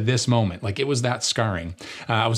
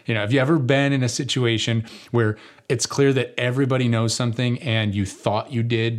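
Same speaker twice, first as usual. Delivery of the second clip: ordinary talk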